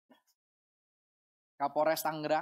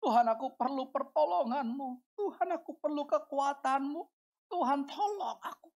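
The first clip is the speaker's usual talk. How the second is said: higher-pitched, pleading mock sob; imitating tearful prayer; 6 semitones higher than usual. Preacher showing a worshipper begging God for help, imitated crying. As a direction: act out a tearful plea for help. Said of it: "cuma nangis".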